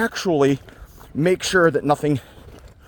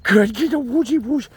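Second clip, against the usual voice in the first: much higher cooing praise; 10 semitones higher, slower than usual. Real, not acted. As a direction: gush over the dog in a high voice. Praising the dog, baby talk.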